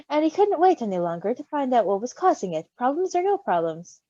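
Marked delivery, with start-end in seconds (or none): none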